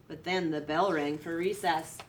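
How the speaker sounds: ordinary delivery, nothing marked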